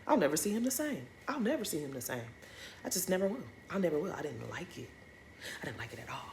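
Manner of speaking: very soft, sinking mutter; hushed, fading with lingering distaste; quiet disapproval. Real, not acted. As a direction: mutter it almost to yourself. Dwelling on her disgust, voice drops away.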